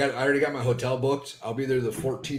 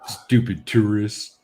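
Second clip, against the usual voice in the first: slow, louder mock disdain; 7 dB louder, much slower than usual. Real, not acted. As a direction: drag it out with contempt. Mocking remark about Vegas.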